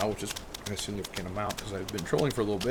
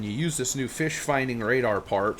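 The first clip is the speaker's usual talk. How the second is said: usual speaking voice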